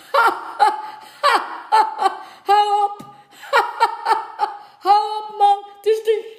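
high, loud laughing through the words; helpless laughter. A narrator's reading. Pastor begging his guest to stop, laughing high and loud. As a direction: laugh so hard you can barely say stop. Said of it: "Toen begon de pastoor te lachen".

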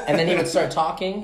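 ordinary delivery

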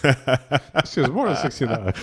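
words through laughter; laughing. Real, not acted. Laughing while talking.

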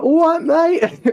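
a mock cockney-style British accent, stretched out loud; common British accent. Mocking how American actors do a common British accent, hammed-up and loud. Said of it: "royalty or common".

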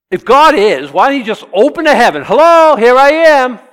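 loud, high shout building to a long hello; booming call. Acted. Preacher acting out God announcing himself from heaven, booming call. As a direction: call out from heaven at full volume.

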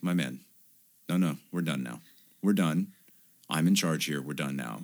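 low, soft, settling it down; calm boss. Retold or imitated. Businessman silencing his brother, low and soft. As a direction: hush him, quiet and final. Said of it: "and he's like".

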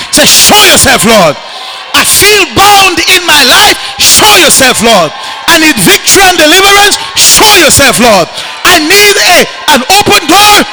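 loud, rising pleading prayer; preacher leading the church in prayer, loud; fervent prayer. Acted. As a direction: beg heaven to break through.

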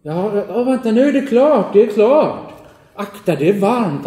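put-on high, loud eager kid; eager boy. Game master as the boy, eagerly offering the stone. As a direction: hurry them along like an excited child.

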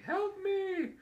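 very high, eerie plea for help; creepy talking bust. Retold or imitated. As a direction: squeak it like a haunted bust. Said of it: "the talking bust saying".

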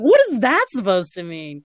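mock affronted comeback; indignant disbelief. Speaker retells answering New Yorkers who hear Valley girl, indignant. Acted baffled reply to accent remark.

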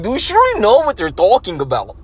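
put-on high, loud, quick Russian-accented challenge. Russian YouTuber challenging rally-goers, put-on Russian accent. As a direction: imitate a Russian needling someone. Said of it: "his Russian accent be killing it".

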